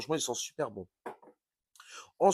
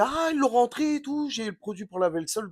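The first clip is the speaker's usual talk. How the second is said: high-pitched, louder quote of a lady; imitated exclaiming lady; 10 semitones higher, 5 dB louder than usual. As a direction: leap up high as you mimic her exclaiming. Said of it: "la dame me disait".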